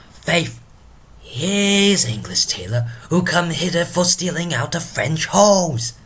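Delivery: high-pitched declaiming that builds in force; Drunken Porter. Acted. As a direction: rant theatrically like a tipsy gatekeeper. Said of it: "let us invite Drunken Porter himself".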